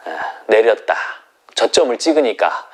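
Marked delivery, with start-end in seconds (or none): none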